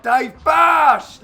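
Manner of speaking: high, loud burst on the last word; shouted shot call. Real, not acted. Commentator breaking off to call a shot, shouting. Calls out the shot mid-sentence.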